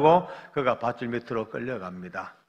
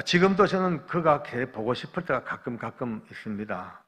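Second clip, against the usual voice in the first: ordinary talk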